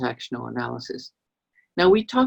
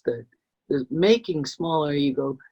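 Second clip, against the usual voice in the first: ordinary talk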